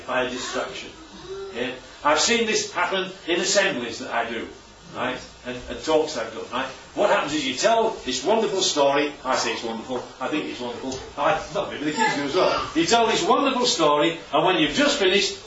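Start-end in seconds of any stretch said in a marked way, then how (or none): none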